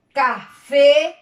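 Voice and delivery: ca, then a strong FÉ in café; stress on last syllable. Shown as a demonstration. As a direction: hit the final FÉ of café. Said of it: "en la última sílaba".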